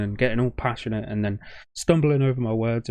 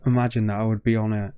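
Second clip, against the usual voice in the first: ordinary talk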